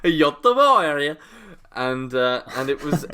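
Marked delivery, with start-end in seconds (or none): 0.0-1.2 "Göteborg" slow and sung, Swedish vowels; Swedish accent. Shown as a demonstration. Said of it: "bring out my Swedish accent".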